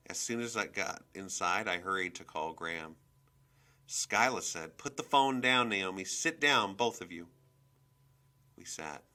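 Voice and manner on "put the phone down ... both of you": raised, loud command; firm, louder scolding voice; stern order. A narrator's reading. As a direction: order the children to sit down. Mother ordering children to sit.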